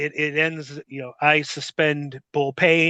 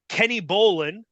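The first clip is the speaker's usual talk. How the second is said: usual speaking voice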